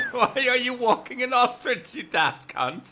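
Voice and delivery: laughing mock yell. Quoting the ostrich video heckler, laughing. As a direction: yell it while cracking up.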